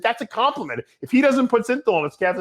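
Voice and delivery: high-pitched retold protest. Defending a joke to his friend, protesting. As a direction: argue your innocence with the pitch jumping up.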